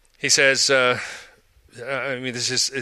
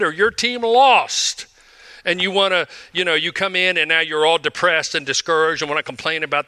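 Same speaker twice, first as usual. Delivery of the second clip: full-voiced sermon storytelling; preaching; 4 semitones higher than usual. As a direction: preach it up to the room. Preacher retells the football rule.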